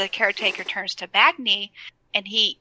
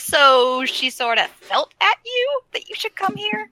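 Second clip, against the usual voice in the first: much higher puzzled dwarf voice; high mock-baffled Bran; Bran's voice; 10 semitones higher than usual. Bran voiced in retold game scene.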